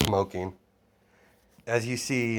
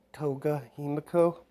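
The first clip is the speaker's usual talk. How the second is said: put-on high little girl's voice; a shy kid giving her name; small child; 6 semitones higher than usual. Orphaned girl answering Lilith, small voice. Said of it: "what's your name".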